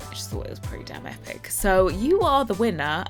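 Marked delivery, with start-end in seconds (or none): 0.1-1.5 fast hushed whisper. Real, not acted. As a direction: breathe it out in awe. Hushed awe at the build.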